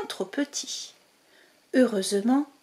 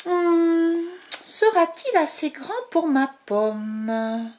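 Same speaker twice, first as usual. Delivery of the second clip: wondering little mouse; 6 semitones higher, slower than usual. Acted. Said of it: "Se demande-t-elle".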